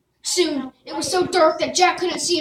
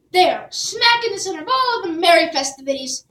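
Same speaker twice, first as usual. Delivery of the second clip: usual speaking voice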